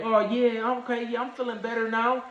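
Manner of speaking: high, light fake-fine voice; faking being fine. To paramedics, brushing it off in a high voice. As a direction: pretend to the paramedics you're okay. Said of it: "And I was like".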